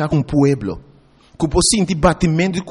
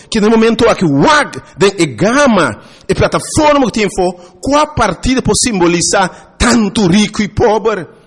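raised, emphatic sermon delivery; impassioned preaching; 4 semitones higher than usual. Acted. Preacher warning the people about choosing their leaders, impassioned preaching. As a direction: preach it out with punched key words.

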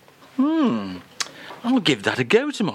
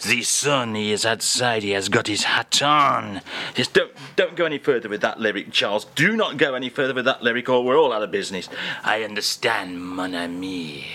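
hammed-up French accent, lightly lower; mock French accent; 3 semitones lower than usual. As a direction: play the French crooner in a comic French accent. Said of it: "[name] is here".

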